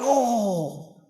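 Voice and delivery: long drawn-out "oh"; startled groan. Retold or imitated. Retelling being kicked in the stomach, mock groan. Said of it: "I wake up like".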